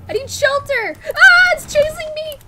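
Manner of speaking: high, loud, slow frightened pleading; scared horror game streamer. Real, not acted. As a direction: whimper and yell while chased. Fleeing enemies in game.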